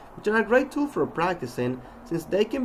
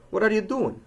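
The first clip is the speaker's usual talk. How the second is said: "What are you doing?" pitched high, then falling; question intonation; 8 semitones higher, 3 dB louder than usual. Shown as a demonstration. Teacher demonstrating asking questions, high then falling "What are you doing?". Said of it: "When asking questions".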